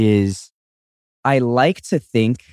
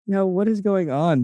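mock bewildered onlooker; 8 semitones higher, 3 dB quieter than usual. Voicing onlookers.

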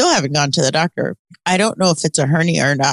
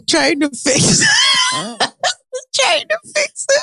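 high, slow, squealing with laughter; can barely get the words out for laughing; helpless giggling; hysterical laughter; slower than usual. Real, not acted.

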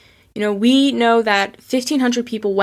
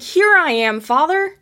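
higher, louder, bright reveal, calling to his father; cheerful announcement; 7 semitones higher, 4 dB louder than usual. Retold or imitated. Son calls to his father on the ship, joyful. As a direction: announce yourself proudly. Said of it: "he's like".

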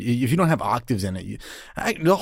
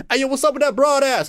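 catcalling guy; 10 semitones higher than usual. Retold or imitated. Catcall voice in wood.

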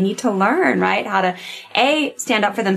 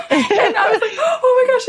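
laughing. Real, not acted. Laughing at the memory.

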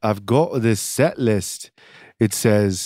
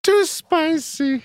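mocking high; slower than usual. Mocking guests' complaint.

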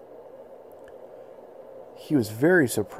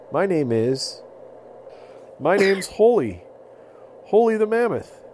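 high, louder proud voice; proud mammoth; 10 semitones higher, 4 dB louder than usual. Acted. Holy the mammoth introducing herself to the boy. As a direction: announce the name proudly.

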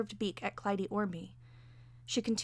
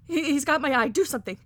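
loud, stammering plea for help; high, desperate yelling; panicked wailing; 6 semitones higher, 14 dB louder than usual. A narrator's reading. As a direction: cry out frantically, building in force. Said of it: "she wailed pathetically".

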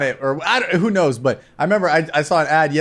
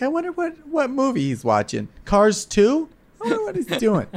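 clueless parent; 8 semitones higher than usual. Retold or imitated. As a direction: play the naive parent who suspects nothing. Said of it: "your parents are like".

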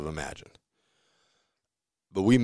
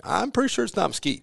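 mock doubtful; 13 semitones higher than usual. Doubting buddy's wood choice.

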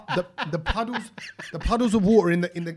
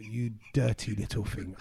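disgusted under the breath; 9 semitones lower, 7 dB quieter, slower than usual. Retold or imitated. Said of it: "And I thought".

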